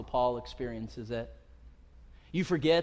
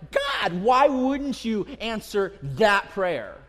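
pleading complaint; 6 semitones higher, 6 dB louder, much slower than usual. Retold or imitated. Voicing believers' complaint to God.

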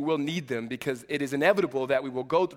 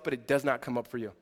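normal talking voice

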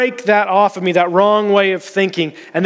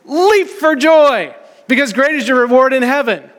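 joyful proclamation; 6 semitones higher than usual. Retold or imitated. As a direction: proclaim it like good news bursting out. Preacher quotes Jesus joyfully.

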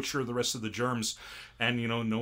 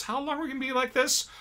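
high, fast whining question; high whiny complaint; whiny cell; 11 semitones higher, faster than usual. Retold or imitated. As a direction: complain like a stuck kid. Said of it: "blood cell says".

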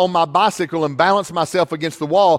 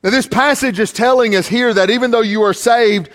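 raised, firm sermon delivery; preaching; 3 semitones higher, 4 dB louder than usual. Acted. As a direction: drive the point home from the pulpit. Preaches to the congregation.

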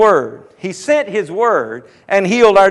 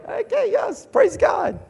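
high exclamation; 12 semitones higher, 5 dB quieter, slower than usual. Real, not acted. Preacher exclaims praise.

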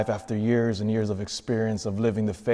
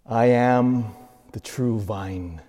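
slow solemn; slower than usual. Real, not acted. Seventh saying, slowed climax.

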